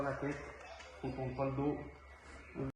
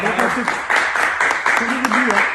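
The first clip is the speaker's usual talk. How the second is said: excited shout; 5 semitones higher, 8 dB louder than usual. Real, not acted. Hyped speech after the fight.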